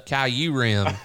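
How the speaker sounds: "Kyurem" drawn out as kai-YOO-rem; mistaken pronunciation. Shown as a demonstration. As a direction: say "Kyurem" slowly, opening with "kai". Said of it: "got roasted for that".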